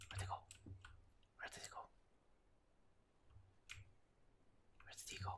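breathy hushed search; whisper. Real, not acted. Gamer hunting a vanished enemy, whispering. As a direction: whisper so he can't hear you.

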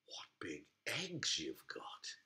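hushed, awed whisper. Acted. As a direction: breathe it in wonder. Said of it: "She said".

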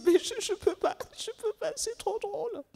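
can't speak for laughing; breathless with laughter; laughing too hard. Acted. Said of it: "Elle rit trop".

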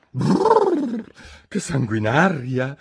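shuddering high exclamation; horrified little outburst; exclaiming. A narrator's reading. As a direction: recoil with a brr, then exclaim. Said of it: "esclamò Alice".

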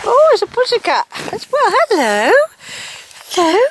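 very high squeal of delight; delighted pet talk. Real, not acted. Spotting a cat, very high delighted voice. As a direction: gush over the cat.

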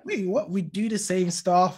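reacting to American salaries; mock disbelief. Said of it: "I'm just like".

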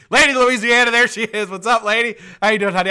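high, loud gushing welcome; excited call-out. Real, not acted. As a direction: announce the guest's arrival with gusto. Hailing an arriving viewer.

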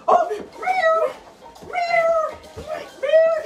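very high, loud meows of a startled cat; a cat yowling as the dog chases it; scared cat meows. Pretend. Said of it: "when the dog sees a kitty cat?".

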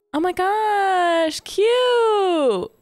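very high, drawn-out squeal; gushing delight. Real, not acted. Player spots a cute character, high gushing squeal. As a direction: squeal over something adorable, stretching the words.

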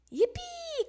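high gleeful cheer; high-pitched whoop; excited cry. A narrator's reading. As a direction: cheer with a high, held final note. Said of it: "cried Froggy".

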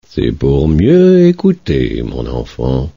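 slow, low and softening sly wolf. Acted. Wolf in grandmother's bed answering the girl, slow and low. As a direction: purr it slowly.

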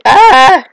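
high, loud game scream. Real, not acted. Player stuck in a game, loud scream. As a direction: holler out wordlessly.

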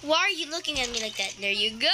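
ordinary talk

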